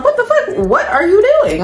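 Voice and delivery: high, laughing what the fuck; laughing high disbelief. Retold or imitated. Turning on her date mid-movie, high laughing disbelief. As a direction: squeal it in shocked disbelief. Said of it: "I'm like".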